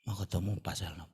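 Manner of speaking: ordinary talk, nothing marked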